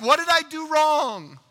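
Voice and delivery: high, loud cry of a parent; anguished self-questioning. Acted. Parent of a wayward child blaming themselves, crying out. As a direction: throw the question up in distress.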